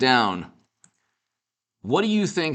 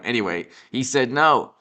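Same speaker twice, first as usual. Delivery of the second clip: usual speaking voice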